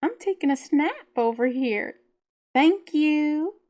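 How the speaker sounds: put-on a bratty teen shushing the room; dog Gracie told off from her sunbeam; Valley Girl sass. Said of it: "adding in her classic Valley Girl sass".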